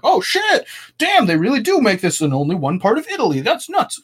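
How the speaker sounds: high squealing shock; mock amazement. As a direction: gasp it out like a dumbfounded tourist. Faked amazement at Googled wine facts.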